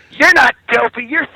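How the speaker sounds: high, sweet princess voice that swears slowly. Retold or imitated.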